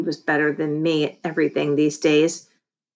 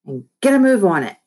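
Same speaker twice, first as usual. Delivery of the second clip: brisk, louder bossy order; best boss voice; 3 semitones higher, 6 dB louder, faster than usual. A narrator's reading. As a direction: hurry them along like the boss. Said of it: "my best boss voice".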